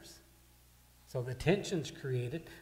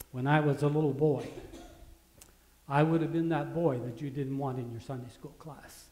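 usual speaking voice

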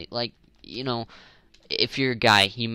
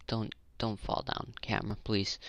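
usual speaking voice

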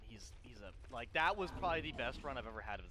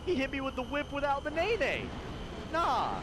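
excited yelling; 13 semitones higher than usual. Real, not acted. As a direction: shout it in disbelief. Reacting to whip attack.

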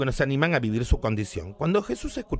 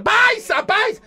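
high shouted call for the servant; 6 dB louder, much slower than usual. Acted. Centurion yelling for his servant. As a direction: yell it across the house.

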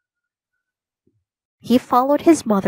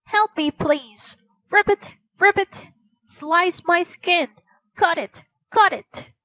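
croaking frog voice; 8 semitones higher than usual. A narrator's reading. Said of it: "the frog croaked again".

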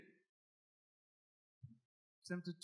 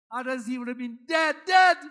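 the second dead shot up high and loud; stress on dead; 5 semitones higher, 13 dB louder than usual. Real, not acted. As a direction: hit the word dead hard. Stressing Peter's danger.

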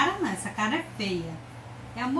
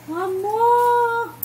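loud high call across the house; loud high call to the daughter; 11 semitones higher, 8 dB louder than usual. Real, not acted. Calling child from afar.

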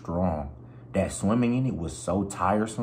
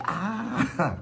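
mock scream; 13 semitones higher than usual. Falling off the cliff into the river, high mock scream. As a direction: yelp like you're dropping off a cliff. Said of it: "but I'm like".

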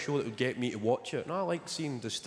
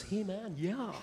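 lightly voiced, higher and softer chirpy shop boy; bouncy shop boy; 8 semitones higher, 4 dB quieter, slower than usual. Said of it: "they bounce".

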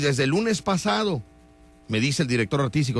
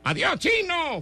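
mock street catcall; slower than usual. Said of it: "me estén gritando".

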